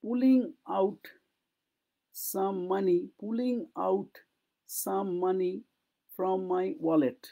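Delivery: pulling out stretched slowly, word by word; ধীরে ধীরে উচ্চারণ অনুশীলন; slow drill. Shown as a demonstration. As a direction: drill the phrase slowly for students to repeat. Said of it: "বলছো তো উচ্চারণটা?".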